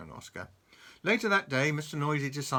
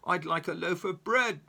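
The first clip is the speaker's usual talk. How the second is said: louder, higher shout; 6 semitones higher, 5 dB louder than usual. Acted. Mister Noisy shouts again at deaf-acting shopkeeper. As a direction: shout it so she hears. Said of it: "Mister Noisy shouted".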